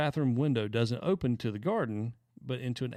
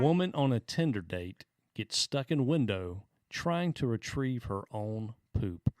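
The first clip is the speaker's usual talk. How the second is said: slow, deliberate headline read; measured re-read so every phrase lands; letting it sink in; slower than usual. Shown as a demonstration. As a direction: spell it out slowly for effect. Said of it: "just so it can sink in".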